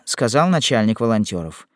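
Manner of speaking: ordinary delivery, nothing marked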